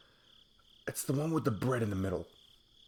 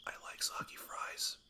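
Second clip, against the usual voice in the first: whispered like a guilty secret; confessing whisper; 7 dB quieter than usual. Acted. Confessing a controversial food take.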